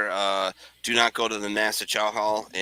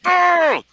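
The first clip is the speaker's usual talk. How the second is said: frustrated brr; 10 dB louder than usual. Retold or imitated. Imitated noise of frustration.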